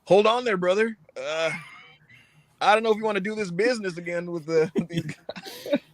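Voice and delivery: hesitant, hammed-up objection that cracks up at the end; Cole reacting to the format. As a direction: play a reluctant wrestler stalling, then laugh. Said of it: "and then Cole is like".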